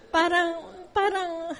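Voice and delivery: slow, choked laugh-cry; too happy to find the words; tearful happy stammer; laughing through tears. Real, not acted. Overwhelmed with happiness.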